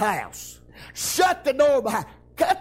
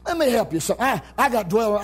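ordinary talk

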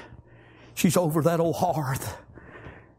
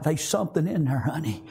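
normal talking voice

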